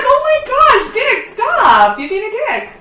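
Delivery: high mock-scolding contestant; giddy contestant. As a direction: play the flustered winner scolding him.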